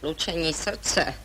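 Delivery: slow, soft scornful repetition; mild derisive mimicry; mocking echo. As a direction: sneer his words back at him slowly. Mocking his palpitations.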